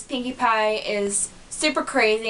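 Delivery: ordinary talk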